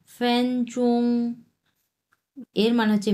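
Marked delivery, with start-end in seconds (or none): 0.1-1.3 ফ্যানচোং held level and high on both syllables, slowly; drawn-out flat first-tone ফ্যানচোং; Chinese first tones. Shown as a demonstration. Teaching students a Chinese word: slow, level tones. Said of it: "দুটাই এক number tone".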